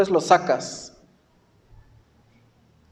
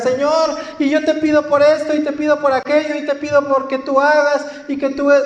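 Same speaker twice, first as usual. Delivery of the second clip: high, fast, breathless list of requests; anxious pleading prayer; 6 semitones higher, faster than usual. Retold or imitated. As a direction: rattle off pleas to God in a rush. Believer rushing a prayer to God. (súplica rápida y aguda)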